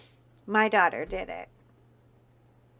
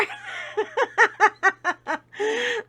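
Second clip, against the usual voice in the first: a bright, loud burst of laughter; high laugh; 10 semitones higher, 5 dB louder than usual. Real, not acted. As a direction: laugh out loud at your own joke. Laughs after joke.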